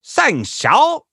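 high, loud SHANGQIAO swooping up; corrective Chinese pronunciation. Retold or imitated. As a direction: demonstrate SHANGQIAO with exaggerated tones. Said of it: "Listen to the pronunciation".

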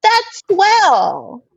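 high-pitched, delighted exclamation; happy call. A narrator's reading. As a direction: beam it out in a high voice. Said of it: "a happy voice calls out unexpectedly".

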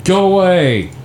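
half-acted frantic shouts; retold yelling. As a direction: shoo away the visions, urgently. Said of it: "And I just start yelling".

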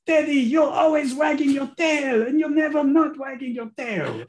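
high, loud, hammed-up scolding dad; mock yelling dad. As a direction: ham up the dad's ranting scold. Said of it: "it's just silly".

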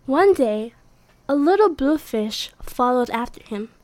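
plain reading voice

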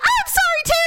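very high-pitched mock scream; squealed, exasperated retort; screamed apology. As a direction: shriek it back in a hammed-up huff. Screamed reply in retold quarrel.

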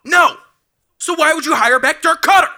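real high-pitched, outraged shout; fired-up shouting. Real, not acted. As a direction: yell it out, exasperated and fired up. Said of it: "We don't need to get you too fired up".

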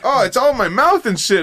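high-pitched imitation of a sprayed kid; mock grossed-out protest. Retelling a kid's complaint after being sprayed, mock protest. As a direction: complain high, as the prank victim.

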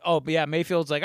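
usual speaking voice

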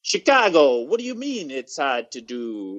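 put-on drawn-out Chicago accent; slow, exaggerated Chicago street voice; mock Chicago accent. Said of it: "That's what everybody sounds like".

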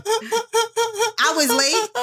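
high laughing shout; loud laughing. Real, not acted. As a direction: burst out laughing. Bursting out laughing.

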